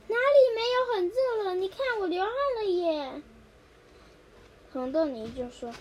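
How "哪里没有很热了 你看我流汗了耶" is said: high, loud squabble; squabbling. Acted. Mung bean ice squabbling with his sister, high and loud. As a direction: argue back petulantly. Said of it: "吵着说".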